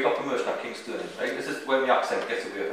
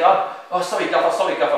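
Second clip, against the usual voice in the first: imitated hasty apology, higher voice; mock apologetic; 6 semitones higher, 5 dB louder than usual. Sent-off player to his manager, imitated apology. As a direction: play a sheepish player, pitched up.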